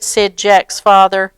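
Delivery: plain reading voice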